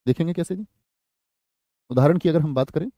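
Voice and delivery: normal talking voice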